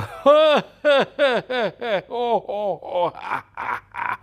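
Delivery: put-on high gleeful baby giggle; baby's laugh. Baby Lina laughing at her mother, high giggle. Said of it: "Y Lina se ríe".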